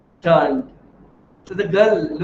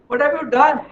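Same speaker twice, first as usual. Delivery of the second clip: higher, the anguish sinking back; softer repeated lament; 7 semitones higher than usual. Acted. Man echoes his plea to Lord, fading lament. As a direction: repeat it, the cry fading into grief. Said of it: "he starts crying".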